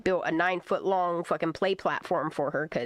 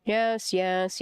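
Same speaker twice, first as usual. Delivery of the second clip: normal talking voice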